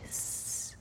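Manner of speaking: soft, voiceless "shh"; hushed shush. A narrator's reading. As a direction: shush them under your breath. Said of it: "του είπε σίγανα". (σιγανό, άφωνο σσσ)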